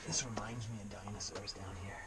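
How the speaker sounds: whispering. Real, not acted. Whispering in the cave.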